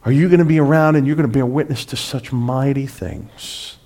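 normal talking voice

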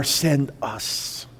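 low, hushed reply to God; quiet surrender; humble plea. Acted. Congregation's reply, first take.

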